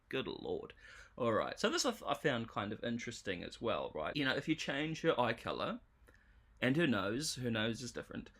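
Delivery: ordinary talk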